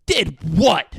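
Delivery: loud, high burst of disbelief; mock outraged question. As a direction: demand it loudly, as if affronted. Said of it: "He goes".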